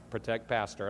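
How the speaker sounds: lightly voiced robot, low and soft; a hint of robotic monotone. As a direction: imitate a robot on a mission. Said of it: "it's just like a, a robot".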